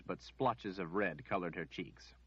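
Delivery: ordinary delivery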